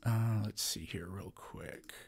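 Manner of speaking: hushed unvoiced aside; whispering. Real, not acted. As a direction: whisper to yourself while searching. Muttering while looking something up.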